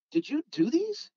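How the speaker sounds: high-pitched, impressed asking; retold amazed question. Hobbyist asking who made the custom cars, retold amazement.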